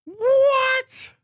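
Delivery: high squeaky disbelief; stunned, voice shooting way up; shocked. Real, not acted. Shocked reaction to pick.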